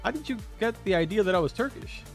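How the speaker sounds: normal talking voice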